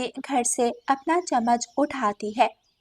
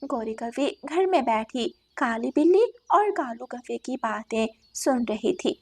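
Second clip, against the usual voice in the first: usual speaking voice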